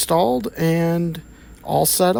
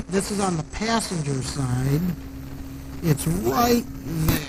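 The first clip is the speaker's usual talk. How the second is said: muffled from under the car; speaking from underneath a car; 7 dB quieter than usual. Real, not acted. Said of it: "but I'm under the car".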